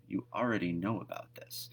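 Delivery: hushed whisper to the viewers; whispered aside. Real, not acted.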